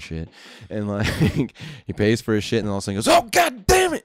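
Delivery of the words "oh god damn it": high, loud mock-angry yell; angry outburst. Drunk customer flicking off a lit cigarette, angry outburst. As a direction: burst out like an irate drunk customer. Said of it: "he got so mad".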